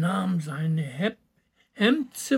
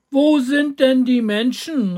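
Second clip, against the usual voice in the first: higher, louder called question; calling up; 4 semitones higher, 6 dB louder than usual. A narrator's reading. Little boy asking the rooster overhead, calling up. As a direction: call the question up to someone above. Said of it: "rief der kleine Häwelmann hinauf".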